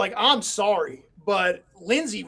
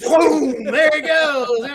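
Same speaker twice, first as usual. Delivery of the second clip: loud, high, drawn-out whoosh of wonder; mock excitement; 5 semitones higher, 6 dB louder, much slower than usual. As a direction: play up the big ignition, slowly. Acting out flame igniting.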